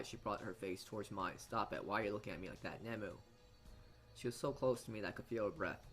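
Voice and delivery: normal talking voice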